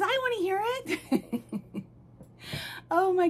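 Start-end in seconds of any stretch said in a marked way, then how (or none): 0.0-1.4 put-on high, laughing little-boy doll voice; playful high put-on child voice; doll's voice. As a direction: pipe up as the baby doll. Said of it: "He says".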